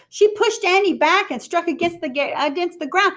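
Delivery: usual speaking voice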